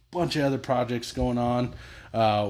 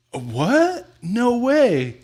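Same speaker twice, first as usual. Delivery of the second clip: high, loud mock disbelief; fake surprise; 10 semitones higher, 7 dB louder, much slower than usual. Dad faking shock to his son. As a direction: overplay the shock. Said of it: "I was like".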